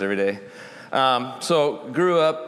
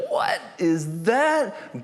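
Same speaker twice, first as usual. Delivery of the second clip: puzzled crowd; 7 semitones higher, much slower than usual. Retold or imitated. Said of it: "people are going".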